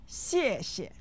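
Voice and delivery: 谢谢 with a falling fourth tone on the first 谢 and a light, toneless second 谢; fourth and neutral tone. Shown as a demonstration. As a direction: say 谢谢: xiè falls sharply, xie is short and unstressed. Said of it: "fourth tone on the first syllable and the neutral tone on the second".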